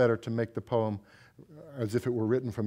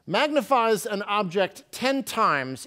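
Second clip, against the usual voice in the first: higher, louder opening line; poem opening; 9 semitones higher, 4 dB louder than usual. Acted. Poet opening her magnifying glass poem, higher and louder. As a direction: lift the first line of the poem.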